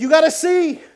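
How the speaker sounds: high, loud, eager urging; excited insistence. Retold or imitated. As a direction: imitate an old man insisting excitedly. Old man insists, acted out.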